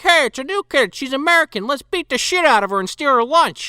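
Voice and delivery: put-on high-pitched jeering kid bully; mock school bully. Imitating bullies spotting the new American girl, high jeer.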